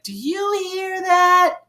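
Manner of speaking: put-on high, drawn-out old lady question; lightly mocking, high-pitched old wife. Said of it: "when the old couple, she's like".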